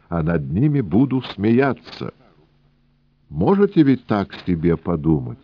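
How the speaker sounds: put-on high, sing-song Yiddish-accented old man; wheedling old trader with Yiddish lilt. Rubinchik confides.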